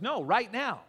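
loud, high, emphatic refusal to wait; impatient insistence. Retold or imitated. Patient wants his kids saved immediately, impatient insistence. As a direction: cut in with a forceful demand.

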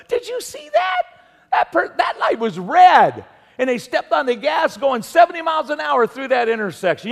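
high, loud, shocked exclamation; half-shouted disbelief at a reckless driver; shocked outburst. Retold or imitated. Husband and wife at the light, loudly shocked by a driver. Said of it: "We go".

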